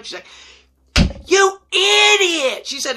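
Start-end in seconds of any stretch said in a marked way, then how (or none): 1.3-2.7 high, loud, drawn-out rebuke; retold outraged girlfriend, stretched out slowly; exasperated scolding. Girlfriend scolds him in story.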